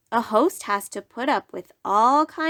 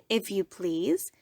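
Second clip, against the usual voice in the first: ordinary delivery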